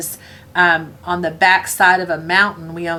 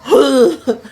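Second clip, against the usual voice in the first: loud high mock retch; retching noise; 7 semitones higher, 6 dB louder than usual. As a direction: imitate a man heaving. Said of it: "he goes down and he goes".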